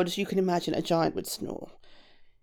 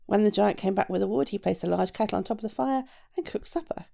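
plain reading voice

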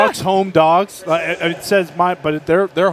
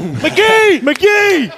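calling out; 13 semitones higher, 4 dB louder than usual. Real, not acted. Calling out to arriving guest.

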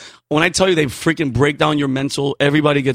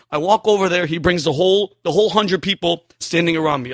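ordinary talk, nothing marked

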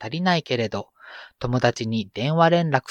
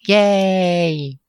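excited cheer; 8 semitones higher, 4 dB louder, much slower than usual. Acted. Cheering the letters segment.

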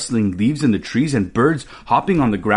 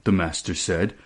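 ordinary delivery

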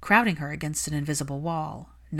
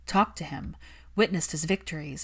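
plain reading voice

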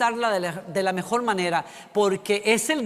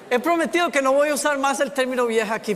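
fast and loud through laughter; laughing; 6 semitones higher, 4 dB louder, faster than usual. Real, not acted. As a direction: talk quickly while laughing. Still laughing while explaining. (hablando rápido entre risas)

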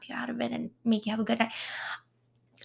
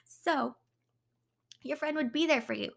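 ordinary talk, nothing marked

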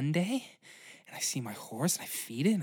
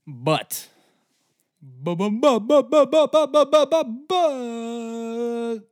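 loud, high rapid stammer; mock dramatic stutter; 10 semitones higher, 8 dB louder, slower than usual. Stammering dramatically before the mutiny, high and loud.

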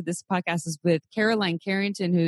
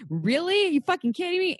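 pitched way up in mock disbelief; retold outrage; 12 semitones higher than usual. Venting to a co-host about a rude email, high incredulous outrage. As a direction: replay your outraged reaction high and incredulous. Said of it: "angry then".

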